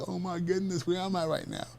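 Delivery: high-pitched, incredulous inner voice; stunned disbelief. Retold or imitated. New principal thinking to himself after a student's insult, stunned disbelief. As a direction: think it aloud in bewildered disbelief.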